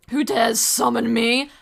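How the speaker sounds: slow, loud, booming demon. Pretend. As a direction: summon the dark lord voice. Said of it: "I play a demon character".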